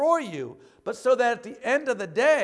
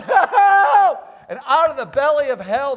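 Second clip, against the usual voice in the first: high, loud yelp of a desperate Jonah, laughing; hammed-up shout for rescue, half laughing; mock cry for help; 6 semitones higher, 12 dB louder than usual. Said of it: "Jonah said".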